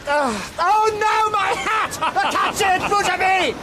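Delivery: alarmed shout. Acted. Friend shouting in alarm as his hat blows away. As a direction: yell in panic as the hat blows off.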